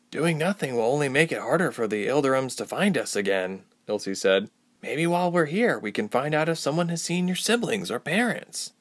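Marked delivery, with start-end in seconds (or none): 0.1-3.6 higher-pitched, reasoning heroine; a hint of the heroine's higher voice; lightly voiced Ilse. 4.8-8.7 higher-pitched heroine making a suggestion; young traveler's higher voice, proposing a plan; suggesting Ilse. Acted.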